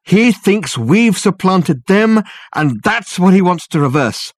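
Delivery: high, loud, urgent realization; urgent revelation. A narrator's reading. Dirk revealing the ghost's purpose to Reg and Richard, urgently. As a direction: hammer home the horror as it dawns.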